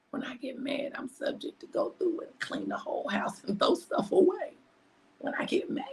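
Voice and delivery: secretive whisper. Real, not acted. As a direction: confess it under your breath. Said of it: "I can't say this out my mouth".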